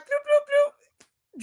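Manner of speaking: high, slow cartoon bloop sounds; bird bloops. Imitating a bird dropping money on her, high slow bloops.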